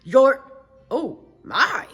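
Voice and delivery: loud scolding turning to shock; father realizing it's his daughter; stern father. Acted. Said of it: "Her father opened the door and said".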